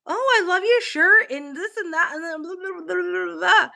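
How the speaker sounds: high, bubbly gushing chatter; chatty Sally. Retold or imitated. To the cashier, bubbly high chatty Sally voice. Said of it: "a chatty Sally".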